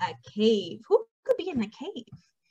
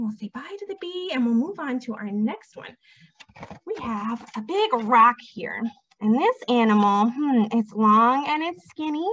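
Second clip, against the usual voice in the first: normal talking voice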